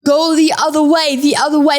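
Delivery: retold yelling. Teammates redirecting her on the field, half-voiced yell. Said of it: "everyone was yelling at me".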